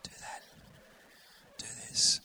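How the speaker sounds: soft whisper from just behind; gentle whispered prompting; whispered inner voice. Acted. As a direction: whisper it into the listener's ear. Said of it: "that voice will tell you".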